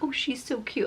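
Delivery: whispered mock sweet lady; hushed imitation of a gushing stranger. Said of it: "She's like".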